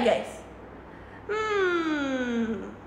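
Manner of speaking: ordinary delivery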